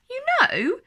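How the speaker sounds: high, loud burst of confidence; a sudden lift of confidence; growing confidence. A narrator's reading. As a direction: perk up, sure of yourself. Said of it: "her confidence growing".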